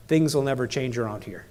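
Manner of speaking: low-pitched, mildly acted resignation; resigned victim. Speaker shows victim language, resigned. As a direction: lightly voice someone who has given up. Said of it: "The V stands for victim".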